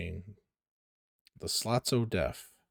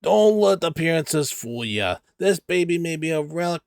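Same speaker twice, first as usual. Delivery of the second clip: salesman voice; 7 semitones higher, 6 dB louder than usual. Acted. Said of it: "I just changed the voice".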